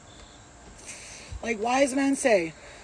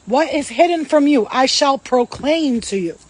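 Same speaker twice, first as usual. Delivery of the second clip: solemn proclamation; 3 semitones higher, 8 dB louder than usual. Acted. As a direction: proclaim it like scripture from the pulpit. Proclaiming scripture.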